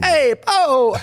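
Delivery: high, loud Italian-American shout; mock Italian-American. Co-host piling onto the Italian-American joke, mock Italian-American. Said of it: "oh, go and speak Italian then".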